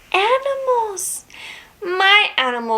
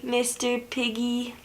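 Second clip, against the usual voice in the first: soft bedtime hush; 4 semitones lower, 11 dB quieter, faster than usual. Acted. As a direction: say it very softly.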